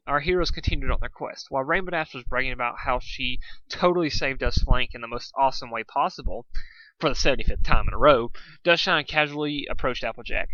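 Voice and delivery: ordinary delivery, nothing marked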